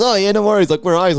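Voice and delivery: a hint of a cheerful mum; mum's voice. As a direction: play the easygoing mum.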